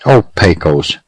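ordinary delivery, nothing marked

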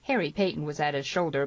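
ordinary delivery, nothing marked

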